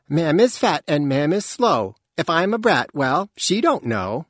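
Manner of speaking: put-on high, singsong little-kid voice; teasing child. A narrator's reading. Scrap teasing her mother in rhyme, high singsong. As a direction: chant the rhyme like a cheeky child.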